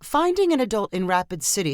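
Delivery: ordinary delivery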